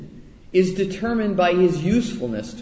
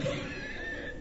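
high laugh; 14 dB quieter than usual. Real, not acted. Laughs at own joke.

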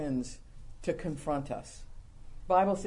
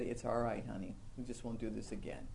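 much softer, tender reassurance; gentle husband reassurance; 8 semitones lower, 11 dB quieter than usual. Retold or imitated. Husband forgiving his wife, softly and tenderly. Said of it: "looked at you and said".

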